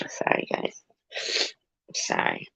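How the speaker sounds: hushed, voiceless apology after crying; soft sniffling sorry to the viewers; sniffly apology. Real, not acted. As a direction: whisper the sorry through sniffles. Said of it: "It's 'cause I was crying".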